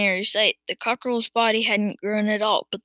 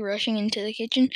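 ordinary delivery, nothing marked

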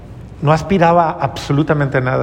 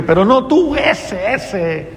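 pitched-up disdainful refusal; contemptuous outburst; 7 semitones higher, slower than usual. Retold or imitated. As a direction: spit out the insult at the dentist. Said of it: "con palabras hirientes".

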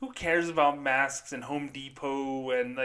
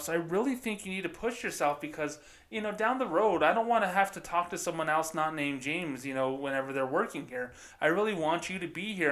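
higher-pitched, falsely encouraging manager; mock passive-aggressive; 4 semitones higher than usual. Mock manager nudging James about missed account goals, passive-aggressive. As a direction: praise him, then needle him while feigning support. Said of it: "that's fucking passive-aggressive as shit".

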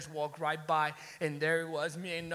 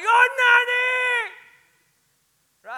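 imitating a fan's excited scream; freaking out; 16 dB louder, faster than usual. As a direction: scream it, beside yourself. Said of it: "Freaking out".

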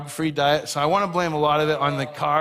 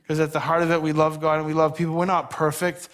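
ordinary talk

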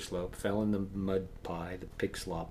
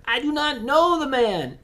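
much higher, loud shouted denial; furious denial; 9 dB louder than usual. Acted. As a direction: shout the lie in panic.

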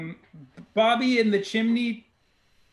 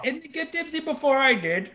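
a higher, teasing Indian lilt; mock Indian accent; 8 semitones higher than usual. Teasing friend about his accent, mock Indian accent. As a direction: imitate his slip into Indian. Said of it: "you said I was turning Indian".

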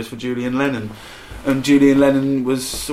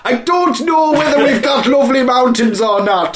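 loud high hammed-up Irish; 11 semitones higher, 5 dB louder than usual. Mock Irish chart host, loud high Irish. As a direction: shout it in a mock Irish lilt. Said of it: "In Ireland, they said".

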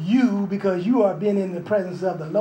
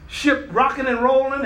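usual speaking voice

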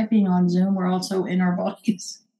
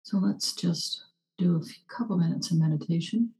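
guided meditation; 2 semitones lower, 3 dB quieter than usual. Acted. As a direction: hush down and guide them inward. Leads a short meditation.

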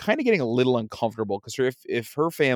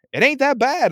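higher, louder, teasing brother; brother's breezy quip; 6 dB louder than usual. Retold or imitated. Brother at the table, shrugging it off, light and high. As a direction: toss it off lightly, amused. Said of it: "was like".

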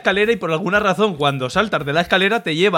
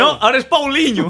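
Paulinho up high, in a Portuguese style; mock Portuguese; 9 semitones higher, 3 dB louder than usual. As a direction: play at sounding Portuguese, laughing. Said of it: "¿Te imaginas que en portugués?".

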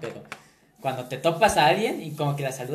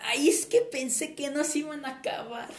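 much higher, softer mock mom excuse; imitating mom; 11 semitones higher, 5 dB quieter than usual. Mom's excuse voiced by son. (disculpa de mamá con voz muy aguda)

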